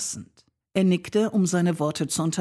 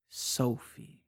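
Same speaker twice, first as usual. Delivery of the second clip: softer and lower, tasting the name; savoring the name; 4 semitones lower, 8 dB quieter than usual. Acted. He repeats her name softly, savoring it. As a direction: roll the name slowly as if tasting it. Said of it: "als würde er ihn kosten".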